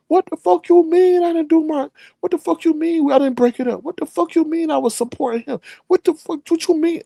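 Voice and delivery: very high-pitched indignant imitation; mock pissed off. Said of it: "the guy gets pissed off".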